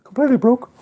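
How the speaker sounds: put-on very high, loud crying-kid voice; a kid wailing to his mum about a hurt wrist; wailing child. A dad imagining his son crying to mom. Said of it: "he would have been like".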